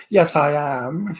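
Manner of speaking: imitating a film star's wonderful voice, low and slow; lightly voiced, stately and drawn-out reply; Katharine Hepburn. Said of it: "you know, with that wonderful voice".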